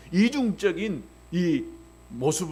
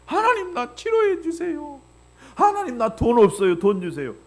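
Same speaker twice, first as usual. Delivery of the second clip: put-on childlike prayer; 8 semitones higher than usual. Pastor modelling a new believer's simple honest prayer. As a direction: plead with God like a small child. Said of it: "이렇게 기도하면 되는 거예요".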